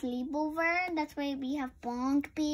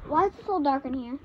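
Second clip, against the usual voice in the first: ordinary delivery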